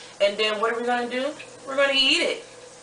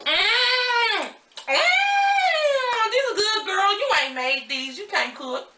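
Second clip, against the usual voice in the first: sassy cousin voice; 14 semitones higher, much slower than usual. Acted. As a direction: play a brash cousin from the Bay. Plays the cousin character.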